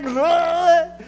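high, loud, stunned cry; bewildered eagle. Retold or imitated. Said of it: "she was sort of like".

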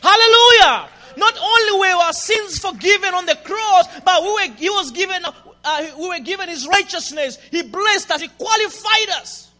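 high, loud, driving sermon; full-force preaching. Real, not acted. Preacher firing up the congregation with mercy.

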